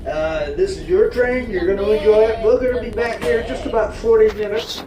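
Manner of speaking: booming conductor's welcome announcement; train announcer. Acted. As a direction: welcome the passengers aboard, announcer style.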